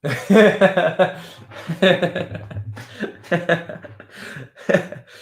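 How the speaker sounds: long loud burst of laughter. Real, not acted. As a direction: crack up laughing. Laughing at a comment.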